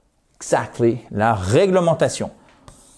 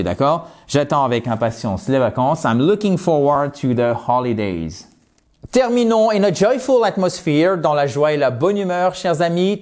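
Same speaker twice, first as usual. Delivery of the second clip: ordinary delivery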